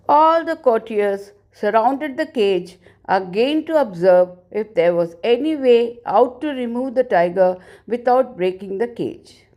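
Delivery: ordinary delivery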